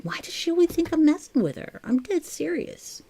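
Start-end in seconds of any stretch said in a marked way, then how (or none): 0.0-1.8 higher-pitched imitation of a puzzled friend; lightly mock her bewilderment in a raised voice; Kathleen, wondering. Crafter imagines viewer wondering why she's doubted, mock-puzzled. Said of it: "she's probably over there wondering".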